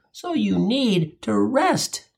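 drawn out, stress on rest; slow it down and lean on rest; slow emphatic punchline. Acted.

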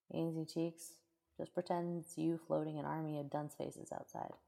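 usual speaking voice

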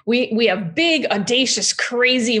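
ordinary talk

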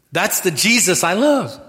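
loud, high preaching cry on love; full-force declaration of love for Jesus; preached out loud. Acted. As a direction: proclaim it to the back row. Preaching climax, repeated later softly.

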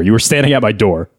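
usual speaking voice

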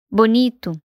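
ordinary delivery

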